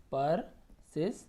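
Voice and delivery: soft, slow gaps inside persistence; broken into syllables. Shown as a demonstration. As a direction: sound out persistence quietly in pieces. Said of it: "तोड़ तोड़ के पढ़िए".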